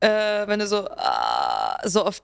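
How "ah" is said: low, soft rasping scream demo; quiet scream. Shown as a demonstration. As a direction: let a quiet growl rasp out. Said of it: "so einen ganz leisen Scream".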